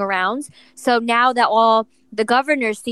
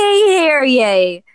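loud proclaiming town crier call; 4 semitones higher, 6 dB louder, slower than usual. Pretend. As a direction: announce it like a herald. Mock proclamation for Joey.

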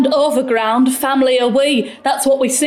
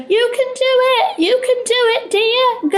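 put-on high, far-off old lady cheer; imitating old auntie; 11 semitones higher than usual. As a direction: cheer him on like a sweet old aunt. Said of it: "she's good at imitating me".

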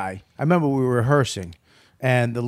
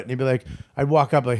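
ordinary talk

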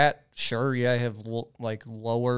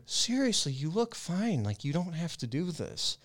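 put-on higher, softer girlfriend voice; 4 semitones higher, 6 dB quieter than usual. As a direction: gently reassure him, higher. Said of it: "the person that you're with is telling you".

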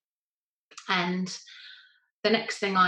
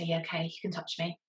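weary, quiet giving in; 2 semitones lower, 9 dB quieter than usual. Retold or imitated. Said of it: "so I say".

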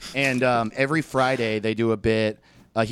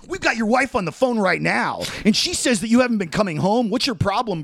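high, quick, smug radio host; mock Ryan Seacrest; 7 semitones higher, faster than usual. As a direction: gloat like a shock-jock springing the trap. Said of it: "And then Ryan's like".

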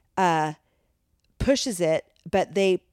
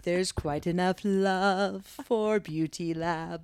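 solemn ceremonial voice; much slower than usual. Acted. Slow mock ceremonial address.

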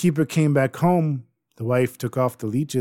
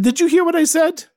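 loud, high bark of a question; impatient demand; 6 dB louder than usual. Acted. Guard demands an answer from the groaning innkeeper. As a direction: snap at him to answer.

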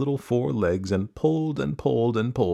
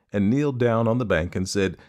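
usual speaking voice

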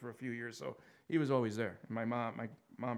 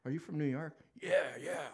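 hammed-up New York accent, a bit louder; mock loud New Yorker; 5 semitones higher, 4 dB louder than usual. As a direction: play the showy New Yorker out of town. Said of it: "They, they, they overemphasize their accent".

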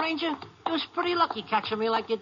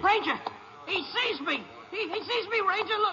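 high, frightened begging; terrified pleading; 8 semitones higher than usual. Acted. Prisoner begs the ranger as Foley spots him, terrified. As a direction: plead with rising panic.

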